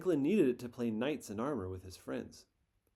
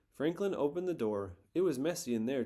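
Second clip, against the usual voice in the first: usual speaking voice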